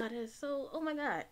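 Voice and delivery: usual speaking voice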